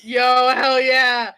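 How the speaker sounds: loud, hearty celebration; excited cheer. Real, not acted. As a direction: cheer loudly for the team's win. Celebrating the peaceful turn in game.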